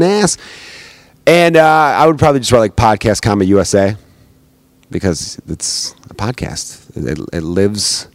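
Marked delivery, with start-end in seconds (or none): 4.9-8.1 whispered, slow and airy; hushed slow mock-spooky aside; mock mystical whisper. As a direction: breathe it like a mystery, slowly. Podcast lives in the air.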